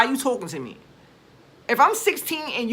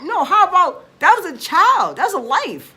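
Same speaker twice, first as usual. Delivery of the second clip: higher and louder burst of indignation; real anger spilling over, pitched up and loud; outraged; 8 semitones higher, 6 dB louder than usual. Real, not acted. Outraged rant about the charges.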